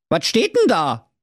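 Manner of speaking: high, baffled Berlin-dialect voice; puzzled Berlin hero. Acted. Schnitzelschorsch puzzled at the king, high Berlin voice. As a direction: play him confused and cheeky.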